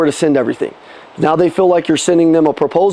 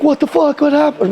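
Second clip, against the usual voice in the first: imitating panicked mom; 7 semitones higher than usual. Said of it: "she's like".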